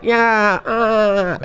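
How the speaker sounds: high, excited squealed yeah; cheering strip club girls. Retold or imitated. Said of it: "the girls are like".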